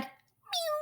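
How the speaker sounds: tiny, very high kitten mew; high, soft kitty voice; frog saying mew. A narrator's reading. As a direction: squeak out small high mews. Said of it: "And the frog said".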